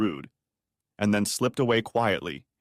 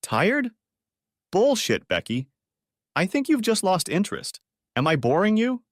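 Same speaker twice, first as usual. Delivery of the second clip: high-pitched, indignant disbelief; exasperated, raised-pitch accusation; incredulous anger; 9 semitones higher than usual. A narrator's reading. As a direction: throw the excuse back, pitch leaping up. Husband angrily disbelieves wife's excuse.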